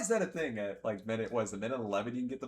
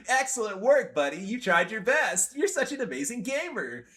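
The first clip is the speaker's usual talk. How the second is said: mock praise; 8 semitones higher, 5 dB louder than usual. Streamer imitating a gloating opponent, mildly sarcastic. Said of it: "you go".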